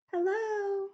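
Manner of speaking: usual speaking voice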